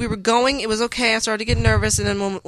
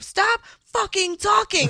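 higher, slowed angry retort; mock yelling; 11 semitones higher, slower than usual. Bride reenacting telling her brother off, slow angry mock. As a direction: drag it out, pitched up and annoyed. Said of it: "I'm like".

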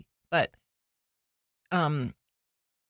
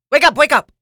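loud, higher wake-up call; urgent self-command; 7 semitones higher, 11 dB louder, faster than usual. Retold or imitated. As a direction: snap yourself awake. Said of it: "I was like".

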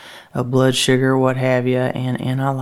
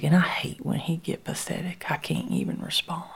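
hushed, barely voiced aside; whispered; 4 semitones higher, 7 dB quieter than usual. Real, not acted. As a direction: whisper the confession. Hushed confession.

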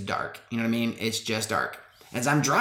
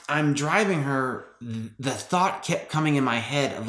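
usual speaking voice

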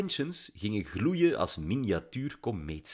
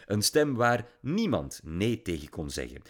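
ordinary delivery, nothing marked

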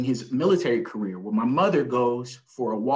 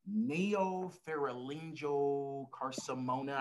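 slowly sounding out a hard word; slow and careful; 7 dB quieter, much slower than usual. Real, not acted. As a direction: carefully spell out each syllable. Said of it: "I never can say it".

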